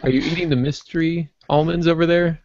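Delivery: normal talking voice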